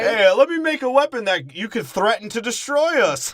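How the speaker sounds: high, loud cheery mock pitch; mock arms dealer. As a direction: sell it like an oblivious salesman. Mocking a dumb arms dealer.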